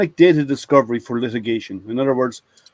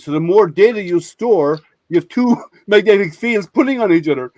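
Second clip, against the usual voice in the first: feed the fake science while cracking up; laughing; 5 semitones higher than usual. Real, not acted. Explaining fake magnet science to the VP, laughing. Delivers absurd punchline laughing.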